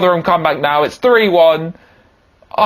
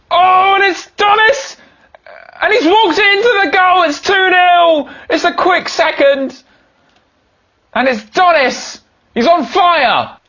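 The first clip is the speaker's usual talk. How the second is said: high, loud, stretched commentator yells; ecstatic goal call; 4 dB louder, slower than usual. Acted. Manager-commentator celebrating a quick second goal, ecstatic high yelling. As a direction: scream the goal like a frenzied commentator.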